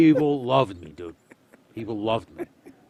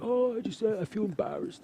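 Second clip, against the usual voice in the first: high, softer, sheepish imitation; embarrassed kid; 11 semitones higher, 5 dB quieter than usual. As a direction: shrink back, imitating a bashful youngster. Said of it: "he felt embarrassed to go".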